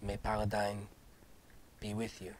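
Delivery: a lightly voiced noble knight, solemn and soft. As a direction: speak as a grave, courtly knight.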